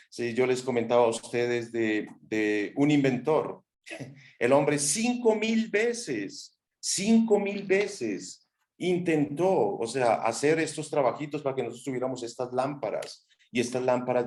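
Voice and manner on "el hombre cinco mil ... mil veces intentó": "cinco mil veces" stretched out slowly and pitched high; slow emphasis. Real, not acted. Speaker marvels at Edison's tries, slow and high.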